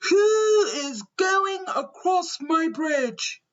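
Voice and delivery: high, slow storybook shout; troll's shout. A narrator's reading. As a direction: stretch the words out as a challenging troll. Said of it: "the troll jumped from under the bridge and shouted".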